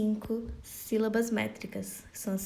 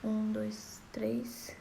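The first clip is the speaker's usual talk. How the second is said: counting that sinks into a whisper; fading count; 6 dB quieter, much slower than usual. Shown as a demonstration. Teacher counts poetic syllables, fading to a whisper. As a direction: count softly until it is only breath. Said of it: "teacher counts syllables aloud".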